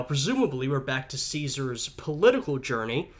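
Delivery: normal talking voice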